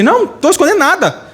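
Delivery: high, hammed-up protest of innocence; defensive denial. Believer caught hiding from Jesus, high defensive denial. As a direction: pitch it up as if caught out. Acted denial when questioned.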